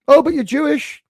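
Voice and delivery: a hint of a startled mother; imitating mom surprised. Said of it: "She's like".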